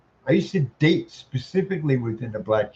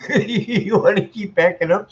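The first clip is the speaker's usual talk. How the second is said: laughing; 7 semitones higher, 5 dB louder than usual. Real, not acted. Laughing at cat backing away.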